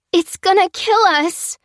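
put-on girl crying out in terror; terrified cry. A narrator's reading. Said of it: "cried Fliss".

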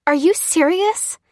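high, loud, drawn-out incredulous question; indignant, rising, slow challenge; outraged disbelief. Acted. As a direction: demand it slowly, voice shooting up. Lucy outraged at the price.

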